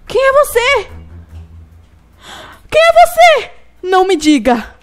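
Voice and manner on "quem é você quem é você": startled shout. Real, not acted. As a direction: cry out in alarm. Stranger appears.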